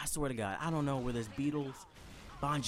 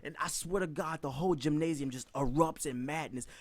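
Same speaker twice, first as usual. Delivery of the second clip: ordinary talk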